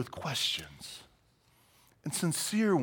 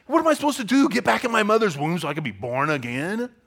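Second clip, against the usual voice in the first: higher, louder mocking question; sarcastic; 5 semitones higher, 7 dB louder than usual. Nicodemus answers Jesus, loud sarcastic mocking question. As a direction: sneer the question back.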